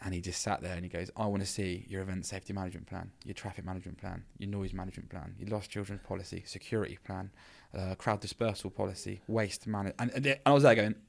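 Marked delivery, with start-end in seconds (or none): 1.1-10.0 stern licensing officer. Retold or imitated. Head of licensing to a novice organiser, stern and quiet. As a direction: reel off requirements softly and sternly. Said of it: "who was a real ball breaker".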